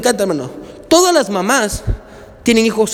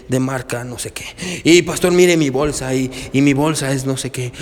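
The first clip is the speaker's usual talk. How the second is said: lower, softer smug bragging; boastful churchgoer; 8 semitones lower, 4 dB quieter than usual. Pretend. Pastor voicing a member bragging about his truck, smug. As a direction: show off lazily to the pastor.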